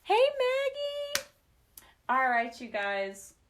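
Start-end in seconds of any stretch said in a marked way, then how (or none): 0.0-1.3 very high, loud, slow hello; high sing-song greeting. Real, not acted. Calling out to Maggie, high and sing-song. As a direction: call out sweetly to the pet.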